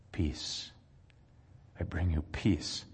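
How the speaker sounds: low, soft and calm; gentle peace. Acted. Jesus greets the fearful disciples with soft, low calm. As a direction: lay the word Peace down gently.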